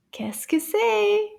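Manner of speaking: high, drawn-out slow question; wondering suspense. Pretend. Teacher asking children to guess the picture, wondering.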